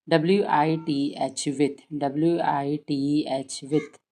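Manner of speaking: slow rote drill. Shown as a demonstration. Teacher shows rote spelling drill for children, slowly. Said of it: "ऐसे रटवाइए".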